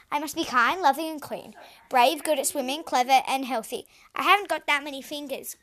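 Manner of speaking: loud, tumbling list of virtues; flustered list. A narrator's reading. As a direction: pile them up in a fluster. Princess overwhelmed listing.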